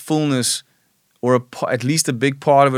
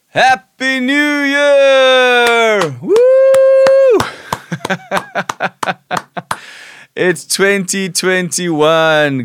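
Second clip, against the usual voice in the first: excited cheering; 11 semitones higher, 7 dB louder, much slower than usual. Real, not acted. New year midnight celebration.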